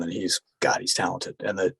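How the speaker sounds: ordinary talk, nothing marked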